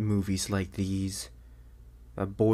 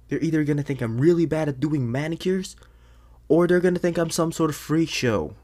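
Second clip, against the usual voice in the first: louder, higher and anxious; a fretful, raised rant; worried outburst; 6 semitones higher, 7 dB louder than usual. Acted. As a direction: spill out the worry getting louder. Fretting about school.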